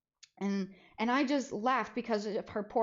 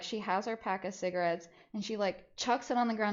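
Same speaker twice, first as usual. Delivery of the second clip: ordinary talk, nothing marked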